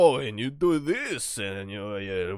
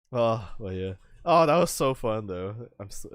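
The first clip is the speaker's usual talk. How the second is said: usual speaking voice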